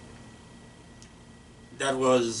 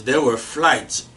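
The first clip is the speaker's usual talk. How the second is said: ordinary talk, nothing marked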